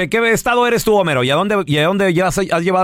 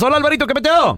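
lively radio greeting; 8 semitones higher, faster than usual. Real, not acted. Host greeting caller on air.

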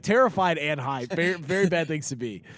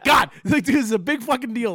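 panicked urging; 7 semitones higher, 6 dB louder than usual. Retold or imitated. Panicked urging friends to rehearse.

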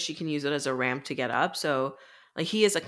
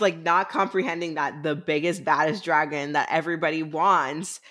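usual speaking voice

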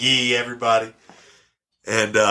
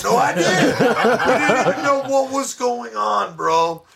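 higher, slow, laughing through the words; 3 dB louder, slower than usual. Real, not acted. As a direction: laugh while you tell it. Laughing at the memory.

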